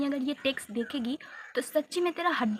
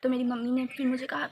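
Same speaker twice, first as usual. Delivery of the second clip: usual speaking voice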